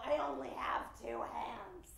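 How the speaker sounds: soft, slow mimicked lament; wearily drawn-out mock complaint; mock martyred complaint. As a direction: imitate a put-upon single mother. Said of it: "And they go".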